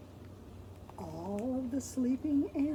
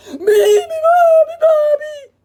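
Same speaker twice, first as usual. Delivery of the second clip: very high loud trumpet call; 17 dB louder than usual. Acted. Elephant trumpets the song loudly. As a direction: blast it like a trumpet. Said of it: "trumpeted the elephant".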